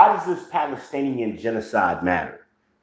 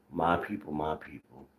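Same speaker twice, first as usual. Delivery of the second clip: sarcastic disgust; 4 semitones lower, 12 dB quieter than usual. Retold or imitated. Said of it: "Sh-, shaking his head".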